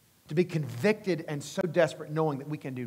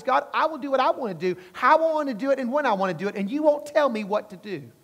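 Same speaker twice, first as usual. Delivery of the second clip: defiant Cain; 9 semitones higher, 6 dB louder than usual. Retold or imitated. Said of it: "bird at God and says".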